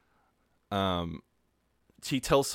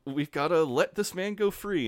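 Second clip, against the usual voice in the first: mock juror; 8 semitones higher than usual.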